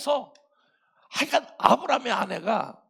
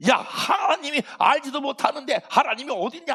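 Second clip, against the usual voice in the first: scoffing passerby; 7 semitones higher, 5 dB louder than usual. Retold or imitated. Passerby mocking Abraham.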